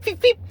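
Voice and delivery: high squeaky feep feep; imitated blade noise. Mimicking a helicopter for the podcast listeners, high-pitched squeak. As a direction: chirp it like fast little rotor blades. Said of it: "the blades are doing the thump".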